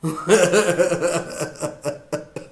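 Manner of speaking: soft trailing chuckle; laugh. Real, not acted. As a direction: chuckle that peters out. Laughs after his own performance.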